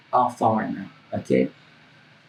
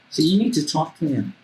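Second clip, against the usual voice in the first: ordinary talk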